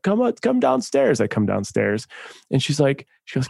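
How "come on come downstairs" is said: mock yell upstairs. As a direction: imitate girlfriend calling up to you. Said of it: "She, like, yells up".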